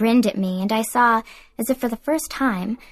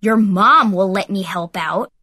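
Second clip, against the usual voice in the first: louder taunting put-down; mocking comeback; 2 semitones lower, 5 dB louder than usual. A narrator's reading. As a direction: sneer it back as a jab.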